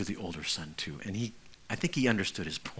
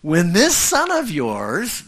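"this" stretched and pushed up, slow and loud; contemptuous stress on "this"; 7 semitones higher, 10 dB louder, slower than usual. Acted. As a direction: lean hard on "this son of yours". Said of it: "notice he says".

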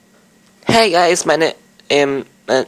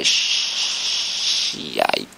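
ordinary talk, nothing marked

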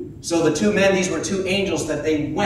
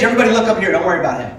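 ordinary talk

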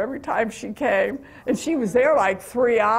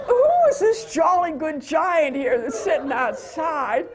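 amused thought; 5 semitones higher than usual. Retold or imitated. Retold thought, laughing.

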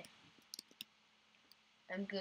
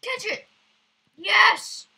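high, loud cheer; high excited shout; excited yell; 11 semitones higher, 15 dB louder, slower than usual. Real, not acted. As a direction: shout when it lands. Game moment excitement.

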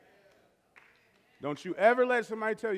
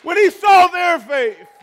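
slow, high, booming preacher's cry; shouted preaching; 9 semitones higher, 16 dB louder, much slower than usual. Acted. Pastor shouting the verse to his church, slow and booming. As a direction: drag out each word like a revival shout.

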